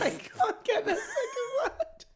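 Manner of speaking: very high, squeaky laugh-talk; real giggles, drawn out and high. Real, not acted. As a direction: squeeze the words out through helpless laughter. Helpless giggles at hard word.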